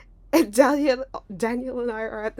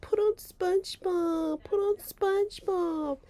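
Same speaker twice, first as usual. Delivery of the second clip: high, slow, drawn-out begging; scared plea; 12 semitones higher, slower than usual. Retold or imitated. Scared kid begging for a cartoon, pleading whine. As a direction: plead like a frightened child.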